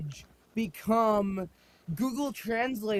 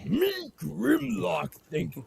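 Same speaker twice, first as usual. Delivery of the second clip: Grimlock voice. Pretend. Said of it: "pull up my Grimlock brain".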